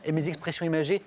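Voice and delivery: usual speaking voice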